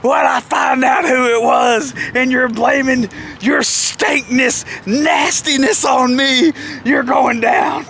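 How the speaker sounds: slow, high-pitched imitation of an outraged boss; mock angry threat. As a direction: drag out the threat in hammed-up indignation. Jeff's furious threat retold.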